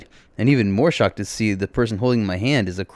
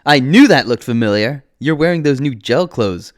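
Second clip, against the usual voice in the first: loud, raised-pitch excited outburst; excited screaming; 5 semitones higher, 10 dB louder than usual. A narrator's reading. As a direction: cry out in thrilled recognition, short of a full scream. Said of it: "Gyogi starts screaming".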